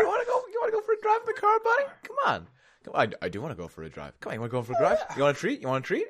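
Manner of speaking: very high, fast, coaxing pet voice; talking to a dog. Pretend. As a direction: sweet-talk a dog into the car.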